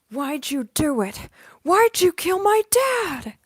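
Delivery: put-on boyish anime heroine's anguished shout; high, loud, drawn-out angry demand, held back from full yelling; Ryuko Matoi. Streamer as anime heroine confronting dad's killer, boyish impassioned cry. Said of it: "Ryuko Matoi impression".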